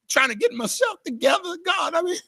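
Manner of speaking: choked up, voice cracking high as he tries to pull himself together; real tears breaking through the words; crying. Real, not acted. Pastor tearing up to the congregation about the anthem, crying. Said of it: "That's why I'm crying".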